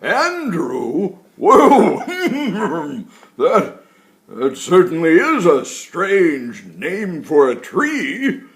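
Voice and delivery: put-on loud, jolly old tree voice; woody tree voice. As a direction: speak like a giant, cheerful old tree. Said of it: "deep, woody-sounding words came out".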